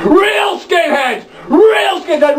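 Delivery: high, slow shouted protest; mock furious skinhead shouting; outraged yell. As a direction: scream it like an offended punk. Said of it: "this one skinhead going".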